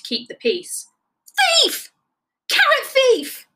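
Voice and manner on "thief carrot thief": angry shout. A narrator's reading. Rabbit accuses Sloth.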